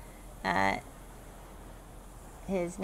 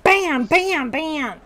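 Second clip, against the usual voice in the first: loud, high bam bam bam; mock hammer bangs; 7 semitones higher, 11 dB louder, much slower than usual. Gnome smashing the player's arm, loud bangs. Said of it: "And then he's like".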